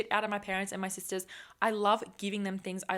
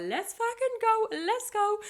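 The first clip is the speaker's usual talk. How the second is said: much higher, louder excited yell, slowed; hyped-up cheer; 13 semitones higher, 5 dB louder, slower than usual. Real, not acted. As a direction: shout it like a victory cry. Hyping herself about merch visions.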